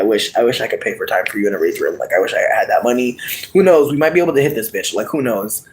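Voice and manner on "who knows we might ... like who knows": ordinary delivery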